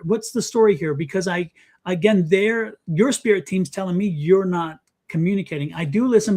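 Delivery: ordinary talk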